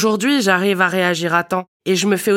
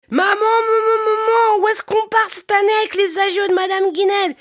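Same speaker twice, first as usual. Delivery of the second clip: put-on excited child shouting; 13 semitones higher than usual. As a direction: shout it like an eager little kid. Banker's child voiced in joke.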